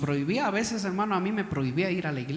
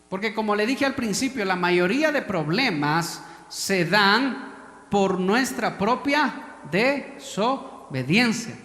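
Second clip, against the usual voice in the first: loud, high, slow preaching; full-voiced sermon climax; preaching at full force; 4 semitones higher, 6 dB louder, slower than usual. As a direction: hammer each phrase.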